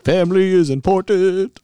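mock Vin Diesel. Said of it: "Vin Diesel wants to do a".

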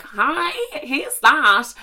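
high, put-on sweet hello; innocent phone greeting. Acts answering the phone.

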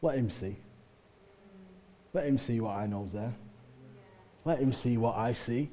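soft, low, unhurried prayer; chilled-out. Acted. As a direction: murmur it, utterly at ease.

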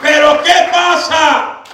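very high, slow shouted question; preacher's shout. Acted. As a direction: call out to the whole church. Preaching climax.

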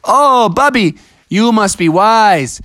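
lightly voiced solemn advice; cautioning Mariana. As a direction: counsel slowly as a wise creature. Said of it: "And Mariana was like".

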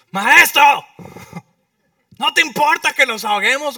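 loud, high panicked plea; frightened disciples shouting at their master. A narrator's reading. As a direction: yell it over the storm.

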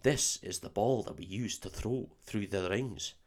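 soft put-on Scottish accent; Oliver Wood. Quidditch keeper teaching Harry, hushed Scottish accent. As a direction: speak low-key, solemn Scots. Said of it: "for me to do a Scottish accent".

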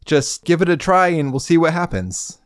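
high, chipper welcome; welcoming guildmaster. Retold or imitated. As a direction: greet every newcomer cheerfully. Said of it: "he's like".

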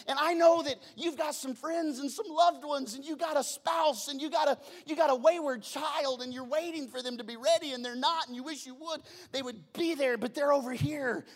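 high, loud fervent preaching. Acted. Pastor urging the congregation about loved ones, fervent and loud. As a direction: drive it home to the congregation.

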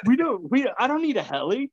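high, insistent refusal; refusing helicopter. Retold or imitated. Telling the paramedic he doesn't need a heli, high and insistent. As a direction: wave off the helicopter. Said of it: "I said".

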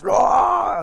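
high, loud mock scream, strained; mock yell. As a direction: howl as you outrun everyone. Possessed man outrunning everyone yelling.

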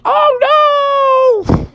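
very high, stretched-out cry; hammed-up cartoon terror; panicked wail. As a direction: howl it in falsetto as the wave hits. Swept away by the wave.

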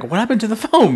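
high shocked exclamation. Retold or imitated. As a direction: gasp out the question, shocked. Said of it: "I was so shocked that I'm like".

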